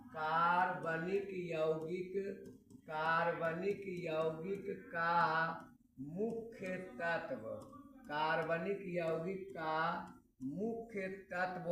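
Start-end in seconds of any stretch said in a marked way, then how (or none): 0.1-7.4 slow, word by word, for students to write down; slow dictation. Shown as a demonstration. Teacher dictating a topic to students, slow and spaced. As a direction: dictate it slowly so they can copy. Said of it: "topic देने जा रहे हैं".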